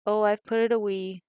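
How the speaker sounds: ordinary delivery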